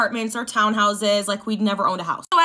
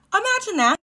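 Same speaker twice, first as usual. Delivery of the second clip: much higher, louder "Imagine that"; 11 semitones higher, 3 dB louder than usual. Shown as a demonstration. Inviting viewers to picture it, high and emphatic. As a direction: push "Imagine" way up.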